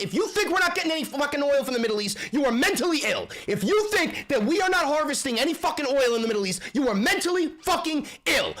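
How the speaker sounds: real yelling at the world, not at the viewer; yelling rant. Real, not acted. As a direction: tear into him, fast and outraged. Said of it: "I'm not yelling at you".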